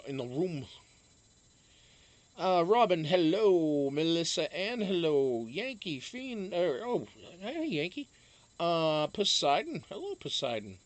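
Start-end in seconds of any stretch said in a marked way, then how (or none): 2.4-10.8 bright, raised livestream greetings; chat shout-outs. Real, not acted. Host greets viewers in the chat room, bright raised greeting. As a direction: call out each viewer's name warmly.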